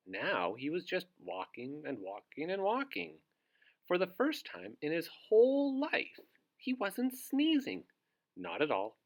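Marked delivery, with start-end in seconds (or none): 3.9-7.8 excited reveal. A narrator's reading. Climax of the story.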